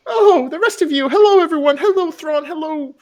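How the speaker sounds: very high, fussing motherly voice; doting Ma. Acted. Ma welcoming the party in, very high and fussing. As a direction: fuss over every guest. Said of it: "she'll come through".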